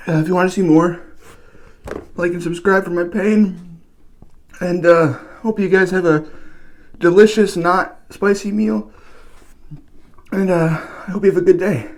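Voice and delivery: giddy, pained outro; strained high sign-off. Real, not acted. Pained sign-off after spice.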